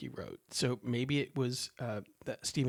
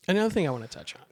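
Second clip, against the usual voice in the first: normal talking voice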